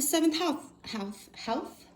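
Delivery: lisped "house" and "health", the final sound turning to th; lisp slip. Real, not acted. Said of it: "I got a lisp".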